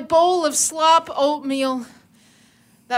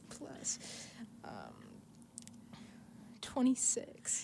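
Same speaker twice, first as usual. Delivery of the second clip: whispered number; whispering; 6 semitones lower, 19 dB quieter than usual. Real, not acted. Whispered roll result.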